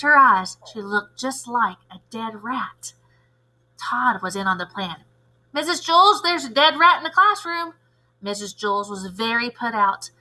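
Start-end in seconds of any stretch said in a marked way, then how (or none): 5.5-7.7 higher and louder alarm call; alarmed kid. Acted. Todd telling Missus Jules about a dead rat, higher and alarmed. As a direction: raise the alarm to the teacher.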